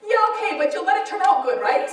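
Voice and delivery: high, eager bargaining; wheedling agreement. Retold or imitated. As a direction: agree quickly, then angle for reassurance. Bargaining with God in retold dialogue.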